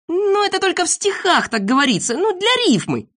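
very high, drawn-up excusing voice; Незнайка explaining. A narrator's reading. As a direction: explain it away in a high-pitched voice. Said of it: "объяснил Незнайка". (оправдываясь, высоким голосом)

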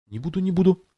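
quick eager promises; Alice hastily assures the mouse; hurried assurance. A narrator's reading. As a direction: rush to reassure. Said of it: "торопливо уверяла Алиса".

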